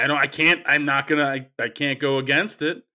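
ordinary delivery

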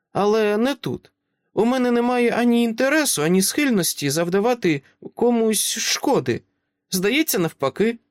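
put-on high child voice, calm and grown-up; a small girl who talks like an adult; composed little girl. Child asks Dresden to let them in, composed and high.

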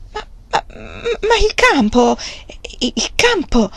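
stammering, halting and slow; a stunned wife stammering. A narrator's reading. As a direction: trip over the words in shock. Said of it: "balbettò lei".

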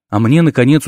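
ordinary delivery

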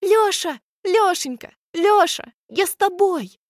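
high shrieking cheers; cheerleader. A narrator's reading. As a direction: squeal your support like a fan. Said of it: "верещит".

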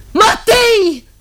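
high calling shout into emptiness; shouting a name. Acted. Woman calling her lost brother, shouting high. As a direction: call out loud to someone far away.

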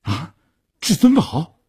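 high-pitched shock on seeing the cards; startled outburst; astonished. A narrator's reading. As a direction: exclaim in disbelief. Stunned by the winning hand.

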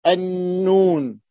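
النون drawn out slowly, the nun doubled; النون held slowly; slow recited example. Shown as a demonstration. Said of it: "اللام".

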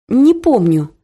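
firm, clearly spoken "Не помню"; every word crisp and strict; clear and stern. A narrator's reading. Daughter curtly shutting down her mother's gossip, strict. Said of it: "внятно и очень строго сказала я".

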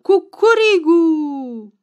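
loud, high, drawn-out cock-a-doodle-doo; commanding rooster crow. A narrator's reading. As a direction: crow like a bossy rooster, stretching it out. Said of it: "cocoșul răspunse mai aspru și mai poruncitor".

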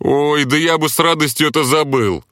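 high mocking groan of distaste; wincing sarcasm. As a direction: wince and mock him. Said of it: "поморщился я".

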